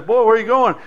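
loud, high call down the street; old preacher calling. Acted. Old preacher washing his car calls loudly to a passing boy. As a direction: hail the boy from the driveway.

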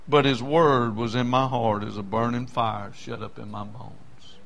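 low, soft, reverent scripture quote; hushed solemnity. Acted. Jeremiah confessing the word burns inside him, hushed solemnity. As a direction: sink down low and let the last word fade.

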